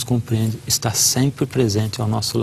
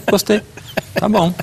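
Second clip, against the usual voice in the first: put-on child's short reply; 9 semitones higher than usual. Son answers father after school.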